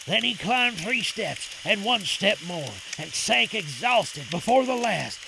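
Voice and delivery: plain reading voice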